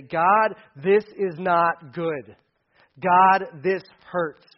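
loud, slow anguished plea; crying out to God. Pretend. Believer cries out to God in pain, loud and slow. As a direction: call out your hurt to heaven.

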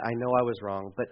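halting, soft plea sinking into resignation; hesitant prayer. Acted. Believer unsure of asking God, faltering and giving up softly. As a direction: start to ask, falter, then drop low. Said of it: "If we have that".

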